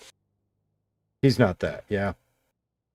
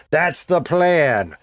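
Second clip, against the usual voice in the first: slow drawn-out repeat; 4 semitones higher, much slower than usual. Real, not acted. Streamer to chat, restating the plan slowly. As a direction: stretch every word out slowly.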